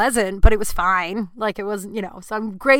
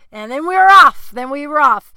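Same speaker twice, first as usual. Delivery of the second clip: excited; 6 semitones higher, 10 dB louder than usual. Real, not acted. Excited punchline repeated.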